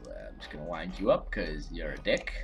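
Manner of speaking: ordinary delivery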